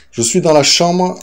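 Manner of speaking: loud call through the house; calling from another room. Real, not acted. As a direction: raise the voice to reach upstairs. Calls out after going downstairs.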